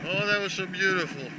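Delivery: normal talking voice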